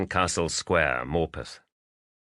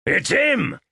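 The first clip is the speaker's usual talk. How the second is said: much higher, louder shout over a roaring waterfall; 6 dB louder than usual. Acted. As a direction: yell it across the gorge. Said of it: "Woods shouted above the thunderous roar".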